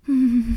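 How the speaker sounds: loud pleasured moan. Real, not acted. Aroused moan in intimate audio.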